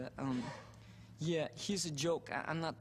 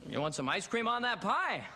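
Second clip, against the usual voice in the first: higher, loud, rising parental offer; overeager parent; 7 semitones higher, 4 dB louder than usual. Acted. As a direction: blurt it out as an amazed, over-accommodating parent. Parent's astonished reply to Brad.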